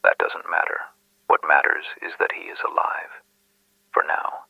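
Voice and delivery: whispered anonymous caller; secretive whisper. Acted. Anonymous caller withholding their identity from the detective, secretive whisper. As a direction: whisper it like a guarded informant.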